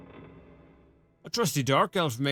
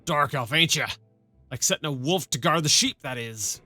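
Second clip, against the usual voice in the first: put-on a rough rural-British-ish sergeant accent, loosely done; half-baked rustic soldier accent; gruff old soldier accent. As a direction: play the one-armed sergeant with a put-on country burr. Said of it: "My accent is terrible".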